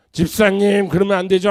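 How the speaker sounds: acted loud admonishment; mock scolding. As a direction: call out a reproach to a deacon. Acts out scolding a church deacon.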